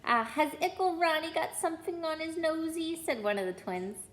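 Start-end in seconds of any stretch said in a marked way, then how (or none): 0.0-3.0 high, cooing teasing voice; mock baby talk. Twin mocks Ron in a high baby voice. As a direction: coo at him like a baby.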